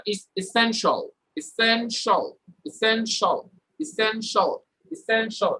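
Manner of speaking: measured drill of এসেনশিয়াল ending in "shol"; pronunciation drill. Shown as a demonstration. Teacher leads students repeating, এসেনশিয়াল as "i-sen-shol". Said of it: "আপনারা বলেন আমার সাথে".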